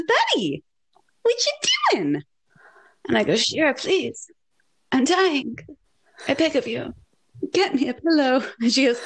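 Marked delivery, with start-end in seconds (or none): 0.0-2.2 high, airy little voice; squeaky mock-innocent question; imitated sister. Imitates sister looking down at her. 3.4-8.4 higher, feeble begging; weak melodramatic plea; mock pleading. Retold begging while sick.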